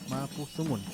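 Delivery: Mapuzungún said softly with a z like th; z pronunciation. Shown as a demonstration. Teacher repeats Mapuzungún to show the z sound. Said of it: "Como en el Mapuzungún".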